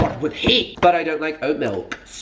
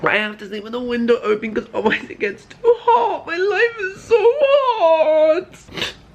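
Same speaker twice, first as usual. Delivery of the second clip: high fake crying whine; mock whining; 13 semitones higher than usual. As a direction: wail it like a spoiled child. Whiny hay fever complaint.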